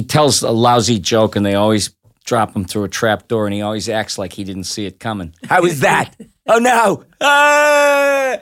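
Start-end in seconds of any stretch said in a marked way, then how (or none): 5.4-8.4 high, shouted cartoon jester. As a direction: yelp as he falls through. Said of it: "I do the Jester".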